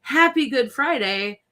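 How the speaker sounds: high, slow sing-song greeting; mock cheery greeting. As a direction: chirp it like a cheery relative. Quoting family text greeting.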